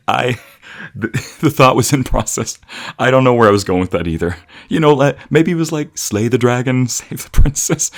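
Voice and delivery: high, loud, breaking up with laughter; words bursting through giggles; laughing uncontrollably. Acted. Caught in his own joke, laughing uncontrollably at his love. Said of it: "You are laughing uncontrollably".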